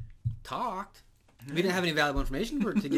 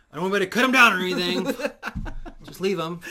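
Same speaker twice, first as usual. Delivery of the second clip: fast, much louder order to leave him; grim captain; 5 semitones higher, 10 dB louder, faster than usual. Retold or imitated. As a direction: snap the order fast and loud. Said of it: "he's like".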